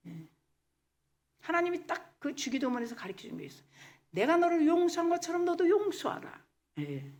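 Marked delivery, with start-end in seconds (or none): 4.1-6.4 God's command. Acted. Preacher quotes God's command.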